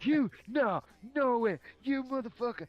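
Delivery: yelling at a friend in disbelief; retold angry yell.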